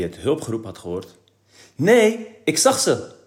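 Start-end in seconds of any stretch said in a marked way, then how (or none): 1.7-3.1 much higher, louder interruption; interrupting. A narrator's reading. Kees interrupting his father's reading, high and loud. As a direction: cut in on the reader loudly. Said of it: "interrupeerde Kees weer".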